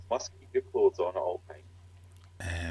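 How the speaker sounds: low slow dry echo of the rule; mock stern echo. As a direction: repeat it slow and deadpan, low.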